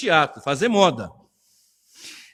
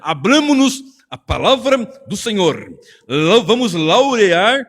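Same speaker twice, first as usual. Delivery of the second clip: slow, louder, grandiose preaching parody; mock pompous preacher; 3 semitones higher, 5 dB louder, slower than usual. Pastor parodying pretentious sermon openings, slow grandiose mock voice. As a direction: stretch the fancy words like a showy preacher. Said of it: "eu nem sei o que que é laurear".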